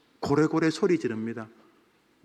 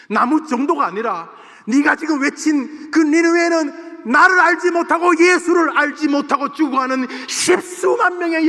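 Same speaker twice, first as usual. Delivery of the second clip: building from raised voice to high, loud furious shouting; God rebuking Jonah in anguished anger; angry God; 9 semitones higher, 8 dB louder than usual. Acted. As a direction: rail at Jonah with tears and rage, louder and louder. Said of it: "분노의 핏줄이 가득한 채로".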